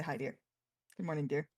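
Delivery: soft, low morning endearment; tender greeting. Acted. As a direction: greet a sweetheart gently, low and hushed.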